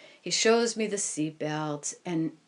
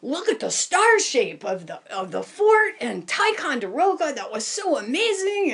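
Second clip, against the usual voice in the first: higher, louder, rising wonder; excited pilot; 6 semitones higher, 4 dB louder than usual. Retold or imitated. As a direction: gush over the view. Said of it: "he's all excited".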